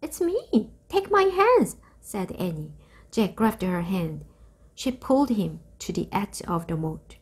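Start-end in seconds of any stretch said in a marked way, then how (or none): 0.0-1.8 urgent. A narrator's reading. Annie reassures terrified Jack.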